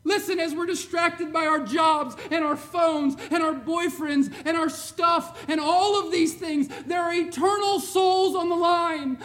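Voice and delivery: loud, driving sermon delivery; preaching at full force. Acted. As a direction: preach it like a wake-up call to the church.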